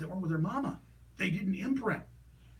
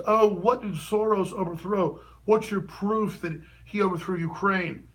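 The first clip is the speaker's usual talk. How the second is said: mock questioner; 7 dB louder, slower than usual. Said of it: "one of them goes".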